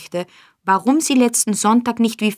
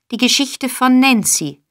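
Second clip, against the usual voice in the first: a sharp higher demand; 5 semitones higher, 5 dB louder than usual. A narrator's reading. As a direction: press the boy for the story.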